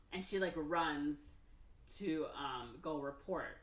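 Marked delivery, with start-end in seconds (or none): none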